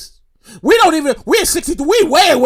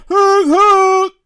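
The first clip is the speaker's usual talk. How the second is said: high drawn-out honks; imitated train horn; 5 semitones higher, 3 dB louder than usual. Rider imitating the oncoming train, honking hoot. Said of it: "And then we hear".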